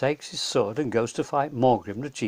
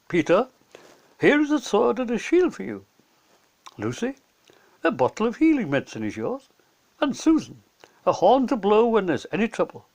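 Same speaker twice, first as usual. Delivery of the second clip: higher, louder cheery gift-giver voice; jolly Father Christmas; 7 semitones higher, 4 dB louder than usual. Acted. Father Christmas hands gifts to the children, cheery and loud. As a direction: beam out each gift like a jolly old saint.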